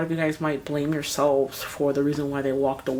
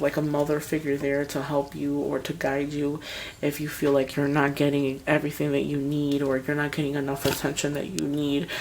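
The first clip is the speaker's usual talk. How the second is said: usual speaking voice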